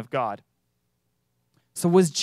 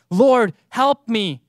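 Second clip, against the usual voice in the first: slow and louder; louder plea; 3 semitones higher, 6 dB louder, much slower than usual. Acted. Preacher repeats the woman's plea louder, slowly. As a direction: press the plea harder. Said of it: "these same words that this woman was using".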